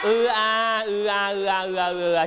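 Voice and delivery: drawn-out "อือ อา" closing up as it repeats; level chant gathering speed. Shown as a demonstration. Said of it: "ออกเสียงช้าๆ แล้วก็ค่อยๆ เร็ว".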